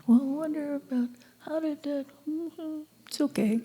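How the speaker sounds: high, soft musing voice; airy mock pondering, pitched high and trailing into a hum; wandering mind. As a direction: play the mind drifting off in idle wonder.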